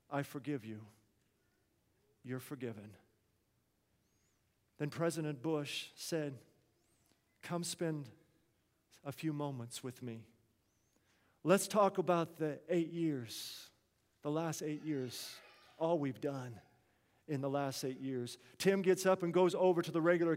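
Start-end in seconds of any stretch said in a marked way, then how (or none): 0.1-2.9 very soft, low reassurance; hushed, sincere pardon; tender forgiveness. Retold or imitated. Retold president repeats his forgiveness. 7.4-18.4 soft, slow reminiscing; quiet, warm retold president; kindly invitation.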